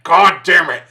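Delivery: loud, fed-up curse; grudging loud give-in; exasperated curse. Retold or imitated. Reviewer moved despite himself, loud exasperated curse. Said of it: "And I was just like".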